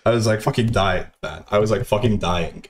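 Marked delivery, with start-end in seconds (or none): none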